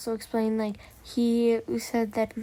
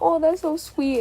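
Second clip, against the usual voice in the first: mock gushing; 6 semitones higher, 5 dB louder than usual. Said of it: "you were like".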